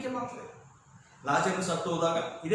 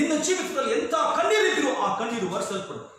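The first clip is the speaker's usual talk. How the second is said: high, raised preaching voice; impassioned promise; 9 semitones higher, 6 dB louder, faster than usual. Real, not acted. Preacher promising that tears will be wiped away, impassioned. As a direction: proclaim it to the whole congregation.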